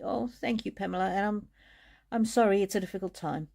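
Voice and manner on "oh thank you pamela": ordinary delivery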